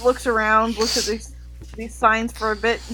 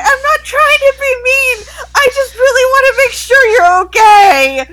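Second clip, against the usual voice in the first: much higher, louder, sobbing through the words; crying shout; 13 semitones higher, 9 dB louder than usual. Acted. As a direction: wail it out while crying. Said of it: "Inez is going".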